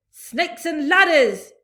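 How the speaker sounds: slow, loud, high outburst; yelled. A narrator's reading. Fire chief yelling in panic. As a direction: bellow it out in alarm. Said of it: "yelled the head of the fire department".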